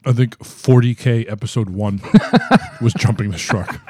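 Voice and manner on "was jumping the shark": laughing through the words. Real, not acted. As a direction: laugh as you say it. Laughing at his own joke.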